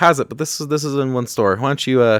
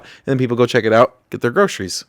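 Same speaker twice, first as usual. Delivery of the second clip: normal talking voice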